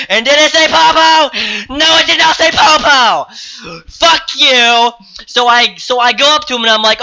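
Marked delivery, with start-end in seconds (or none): 0.1-4.9 high, loud, worked-up rhetorical questioning; fired-up sarcastic build to the answer; indignant rant. Real, not acted. Indignant ranting retelling.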